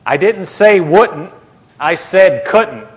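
slow, with "wouldn't" and "couldn't" each set apart; stress on the correction. Retold or imitated. Jesus correcting Brother Hagin, slow emphatic contrast. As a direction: spell out the difference between "wouldn't" and "couldn't".

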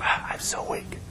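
breath-only whisper, almost inaudible; weak whisper. Retold or imitated. Sick woman whispering to her visitor, frail voiceless whisper. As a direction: whisper it with no strength left. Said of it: "But she was whispering".

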